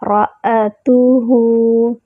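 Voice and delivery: رَأَتُهُ with heavy ra and clear hamza; tajweed demo. Shown as a demonstration. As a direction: say رَأَتُهُ slowly, ra thick. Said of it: "را کو جو ہے وہ موٹا پڑھیں گے".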